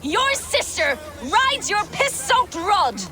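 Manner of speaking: high, loud, drawn-out taunting yell; shouted insult. Acted. Soldier jeering at a rival, shouted taunt. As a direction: hurl the insult slowly at full voice. Warrior yells insult across the camp.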